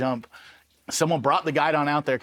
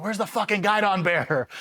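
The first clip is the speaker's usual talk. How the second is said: retold scream; 6 semitones higher than usual. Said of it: "like, screaming through the hallways".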